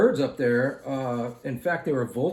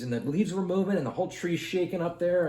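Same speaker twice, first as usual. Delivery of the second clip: ordinary talk, nothing marked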